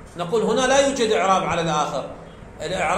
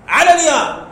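"على الياء" punched higher and louder, landing hard on "على"; emphatic stress; 6 semitones higher, 6 dB louder than usual. Shown as a demonstration. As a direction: hit "على الياء" like the key answer, loud and high. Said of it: "فنبقي الإعراب على ماذا؟". (نبر قوي على "على الياء" بصوت أعلى وأرفع)

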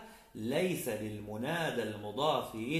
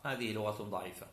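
normal talking voice

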